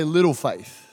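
"Little faith" said low and slow; low drawn-out "Little faith". Shown as a demonstration. Preacher leading the congregation, low and slow. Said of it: "Turn to your neighbor and say".

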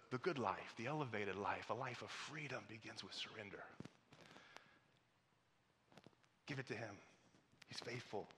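soft, low, weighty preaching with long pauses; quiet, measured pastoral plea; hushed sermon appeal. Real, not acted. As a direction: bring it down to a tender near-whisper.

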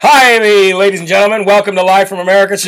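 slurred, run-together anchor greeting; hammed-up gibberish news intro; mock garbled newscast. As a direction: do the news as if your words melt into mush. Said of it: "if I did the news like that".

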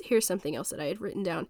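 ordinary talk, nothing marked

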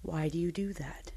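hushed whisper to a misbehaving phone; soft, breath-only reproach; whispered retold reaction. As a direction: whisper it like scolding in secret. Acting whisper to phone in class.

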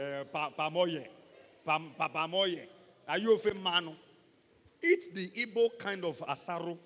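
ordinary delivery

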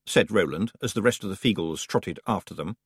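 plain reading voice

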